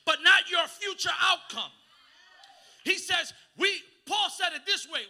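loud, rising sermon climax; fiery pulpit delivery; full force preaching. Acted. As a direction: preach it hard to the back row.

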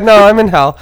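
very high, loud, laughing repeat; helpless laughter over the quoted line; laughing imitation. Real, not acted. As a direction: crack up while mimicking the comeback. Laughing while repeating mom's answer.

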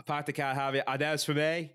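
put-on practice-line Boston accent; badly faked Boston cop accent; attempted Boston accent. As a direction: try out broad Boston vowels. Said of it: "I can't do the Boston accent".

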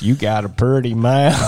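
slow, drawn-out hillbilly drawl; Deliverance hillbilly. Retold or imitated. Quoting Deliverance to tease, slow hillbilly drawl. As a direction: stretch it like a creepy backwoods line. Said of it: "What is that in Deliverance?".

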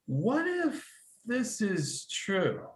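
slow, high, hushed wondering question; slow awestruck murmur; awed wonder. Retold or imitated. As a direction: ask it like you can hardly believe it might be real. Inner question while reading scripture.

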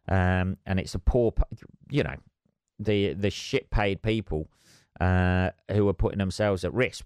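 normal talking voice

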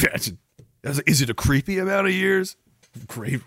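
ordinary talk, nothing marked

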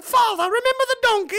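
put-on a high, squeaky kid's voice; child voice. A farm child asks father at dinner about the donkey, high child voice. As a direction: play the eager little kid pestering dad.

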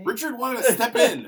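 high, loud, drawn-out insistence; loud interjection. Real, not acted. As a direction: burst in over the others, slowly and high. Friend cuts in about Richard.

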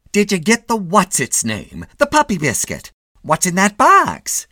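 high, loud scolding wife; a shrill-voiced fussing wife, loud; nagging wife. A narrator's reading. Mrs Mitty questioning.

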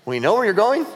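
puzzled, voice climbing at the end; confused disciples, rising query; baffled question. Acted. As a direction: ask it as if totally lost. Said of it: "they're like".

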